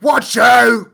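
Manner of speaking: high, loud mock English yell; English-accent battle cry. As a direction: shout a warning in an overdone English accent. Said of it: "They'll be in a position where they're fighting".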